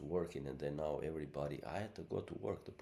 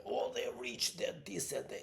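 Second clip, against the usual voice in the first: higher-pitched mimicry of gossiping people; mocking dismissive quote; 7 semitones higher than usual. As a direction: parrot the dismissive crowd in a raised voice. Mimics what everyone is saying.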